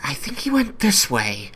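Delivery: a small creature sure of the trail; high-pitched hunting sidekick; high tracker voice. Acted. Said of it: "and you hear".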